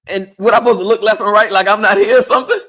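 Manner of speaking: loud, high protest; mock indignation. Passenger objecting to being ignored in the car, indignant. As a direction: raise the voice in mock disbelief.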